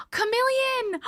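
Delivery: high squeal of delight then a gasp; excited squeal. Real, not acted. Greeting a chatter named Chameleon, delighted squeal and gasp. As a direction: squeal the name and gasp with joy.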